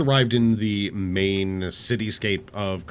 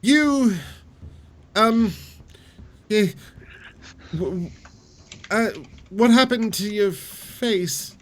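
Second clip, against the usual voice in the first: high, surprised innkeeper voice; startled tavern keeper, higher pitch; 11 semitones higher, 4 dB louder than usual. Acted.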